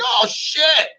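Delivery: hammed-up startled buddy; mock startled. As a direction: squeak out the surprise in a high put-on voice, then laugh. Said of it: "And he'll be like".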